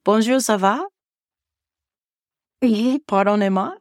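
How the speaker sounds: lightly acted French phrases; speaking French. Teacher surprising her class to get attention, speaking French. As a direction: try out schoolroom French on them. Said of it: "I would be speaking French".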